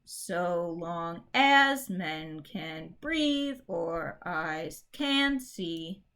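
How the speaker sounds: slow, with the beat on every third syllable; anapestic reading. Shown as a demonstration. Teacher testing anapestic meter, slow and awkward. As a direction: try the da-da-DUM beat. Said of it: "let's try anapestic".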